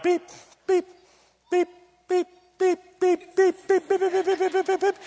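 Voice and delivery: high-pitched electronic beeps, speeding up; high staccato beeping that races into a flurry; imitated heart monitor beeps. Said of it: "it beeps".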